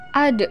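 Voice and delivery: "Ad" with a released, echoing d; "Ad" with a tiny bounce after the stop; bouncing d. Shown as a demonstration. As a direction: let the final d of "Ad" rebound. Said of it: "terdengar suaranya memantul".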